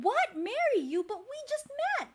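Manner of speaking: mildly startled, pitch jumping up; a touch of high-pitched disbelief; taken aback. A narrator's reading. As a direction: react with surprise, rushing the last phrase. Heroine surprised by marriage proposal.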